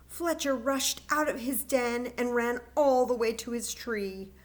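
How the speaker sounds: ordinary delivery, nothing marked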